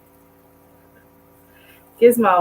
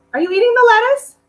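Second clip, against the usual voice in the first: surprised; 9 dB louder than usual. Real, not acted. Catching dog eating lettuce.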